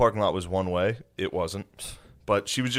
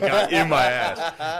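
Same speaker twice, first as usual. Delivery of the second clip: laughing; 7 semitones higher, 6 dB louder, much slower than usual. Real, not acted. Laughing retelling.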